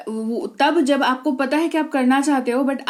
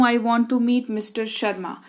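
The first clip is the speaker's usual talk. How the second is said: ordinary talk, nothing marked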